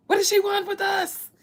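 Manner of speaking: high, loud, indignant ancestor spirit; loud mock spirit demanding why she's here; alarmed spirit voice. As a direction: shriek it as an offended spirit. Spirits questioning her presence.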